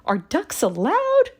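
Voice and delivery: high duck voice; a little duck asking politely, high. A narrator's reading. Duck asks Little Blue to squeeze in, high voiced. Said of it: "said Duck".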